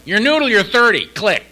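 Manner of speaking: high, loud mock sponsor rebuke; brusque retold outburst. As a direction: imitate a sponsor loudly dismissing a caller. Sponsor's brusque phone answer retold.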